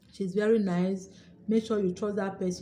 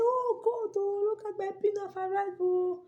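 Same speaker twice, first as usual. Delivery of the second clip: re-enacted happy shouting; 12 semitones higher than usual. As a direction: cry out in a thin, high voice, overjoyed. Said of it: "I was shouting".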